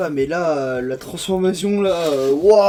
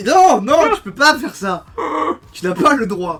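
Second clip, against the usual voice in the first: outraged; 8 semitones higher, 8 dB louder than usual. Real, not acted. Mock outrage at trailer.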